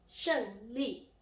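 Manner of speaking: 胜利 with each syllable falling; emphatic falling tones on 胜利; fourth-tone demo. Shown as a demonstration. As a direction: drop the tone firmly on both syllables of 胜利. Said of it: "now the fourth tone".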